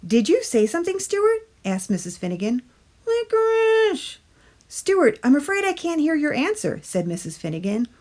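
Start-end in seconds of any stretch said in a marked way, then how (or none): 0.1-1.5 higher, lightly voiced teacher; a hint of a puzzled schoolteacher; teacher questioning Stuart, higher voice. A narrator's reading. 3.1-4.2 high, slow squeaky tooth voice; muffled tooth voice. A narrator's reading. Tooth answers again. 4.7-6.8 higher, lightly voiced teacher; a hint of a puzzled schoolteacher; teacher questioning Stuart, higher voice. A narrator's reading.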